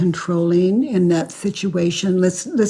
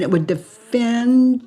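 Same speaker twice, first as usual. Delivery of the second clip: normal talking voice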